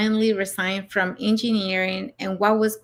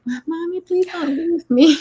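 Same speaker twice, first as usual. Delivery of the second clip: high-pitched mock pleading of a small child; lightly acted tearful kid begging mom to stay; imitating crying child; 8 semitones higher, 5 dB louder than usual. Child begging mom not to leave for work, mock crying. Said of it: "they'll be crying like".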